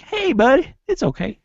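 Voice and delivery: high-pitched cartoon kid imitation; Butters. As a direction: put on a small, high, consoling voice. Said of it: "Butters just runs over and is like".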